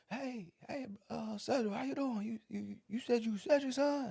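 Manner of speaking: Southern women calling out friendly from a truck; Southern accent. Retold or imitated. Southern women in a car greeting Cedric's son, high Southern accent. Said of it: "every person in that car had an accent too, from the South".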